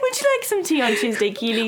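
high, giggly mock British accent; playful British accent through laughter; badly faked British accent. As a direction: offer tea as a hammed-up Brit. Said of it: "I can't do this".

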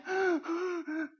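high sharp frightened gasp; startled gasp. Retold or imitated. Preacher acting the driver's fright at police, sharp high gasp. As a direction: gasp like you just saw flashing lights.

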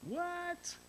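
squeaky high shocked what; stunned disbelief. Retold or imitated. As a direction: voice jumps way up in disbelief. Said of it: "I thought".

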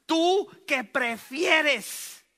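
loud, very slow furious accusation; angry Saúl. Acted. Saúl rebukes Jonatán, loud and very slow. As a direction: drag every word out in rage.

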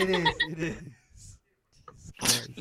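ordinary talk

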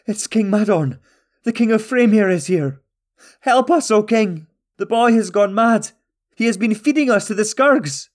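high, loud pleading shouts; chained prisoners calling out to their king for help; desperate cries. A narrator's reading. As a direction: shout to him for rescue.

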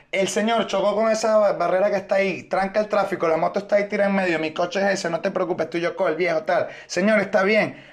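high, rushed explaining; mock doctor in a high hurried voice; hurried doctor act. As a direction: talk fast and high, taking charge.

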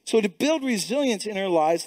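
ordinary talk

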